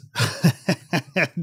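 real high laugh breaking out; quick amused laugh; burst of laughter. Real, not acted. Amused by ghost mural story.

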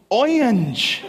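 "orange" with the r dropped, oy-nge; high, drawn-out "orange" as "oyunj"; family's orange. Retold or imitated. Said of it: "My family says orange".

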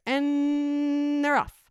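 long drawn-out announcer call; race announcer. Retold or imitated. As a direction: stretch it like the track announcer. Announcer calls race start.

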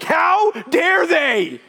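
slow, high, hammed-up indignant customer; an offended customer over a wrong order, slow and high; mock outrage. Indignant drive-through customer.

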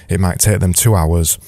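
ordinary delivery

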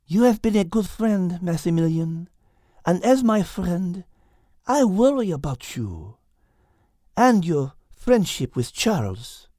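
high, tender French cat voice; tender French-accented cat; Liz, French accent. Acted. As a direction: show her worry gently. Liz's voice.

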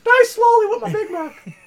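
very high, slow cartoon squeak; Mickey Mouse. Burger mascot threatening a customer, squeaky cartoon menace. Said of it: "sound like fucking Mickey Mouse".

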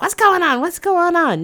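high, loud urgent questions; frantic mom. Retold or imitated. Mom grilling her daughter, high loud urgency. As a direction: fire them off like a worried mother. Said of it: "Mom's like".

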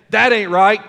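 loud, lightly exaggerated Southern voice; Southern accent. The Holy Spirit warning believers of a lie, in a Southern accent. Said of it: "my Holy Spirit's Southern".

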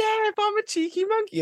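very high-pitched cheeky comeback; cheeky monkey. Acted. Cheeky monkey excusing himself to his parents, very high cheeky voice. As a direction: squeal it as a shameless excuse.

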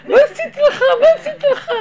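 very high, loud whine through laughter; high laughing plea of innocence; pleading protest. Retold or imitated. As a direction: beg that you did nothing.

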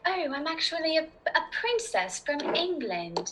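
dainty royal British voice; gentle British princess. Shown as a demonstration. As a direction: play a princess from England in a British accent. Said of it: "you can make it more gentle".

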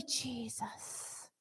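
soft whispered Jesus; whispered devotion; whispered name. Real, not acted. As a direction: breathe the name. Hushed worship.

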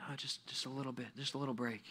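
soft, sleepy excuse; lazy person pleading under the breath; sluggard's reply. Acted. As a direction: mumble quietly like a reluctant riser. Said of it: "the reply".

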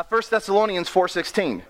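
ordinary delivery

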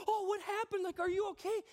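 a hint of a worried wife; imagined wife asking if he's okay; concerned wife. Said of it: "my wife's gonna go".

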